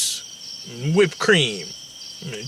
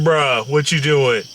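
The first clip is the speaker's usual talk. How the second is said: usual speaking voice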